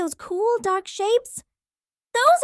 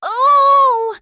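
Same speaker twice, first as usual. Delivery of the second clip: a held, loud oh; long loud oh; 4 semitones higher, 8 dB louder than usual. Shown as a demonstration. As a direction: sing out a long oh to feel it buzz. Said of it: "put your hand on your throat and say".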